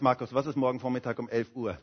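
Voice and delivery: a quick quizzical aside; listener's question. Retold or imitated. Preacher voicing a listener's mild puzzled question. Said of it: "Du sagst".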